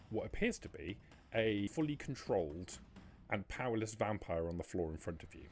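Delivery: ordinary talk, nothing marked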